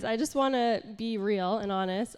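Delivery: ordinary talk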